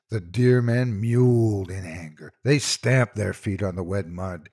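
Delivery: plain reading voice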